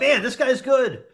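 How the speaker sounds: high, slow, amused admiration; drawn-out impressed reaction with a laugh; impressed viewer. Acted. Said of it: "It's like".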